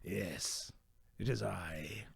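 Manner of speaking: low hushed whisper reveal; dramatic whisper. Acted. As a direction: hush it like a grand entrance. Dramatic actor ghost reveal.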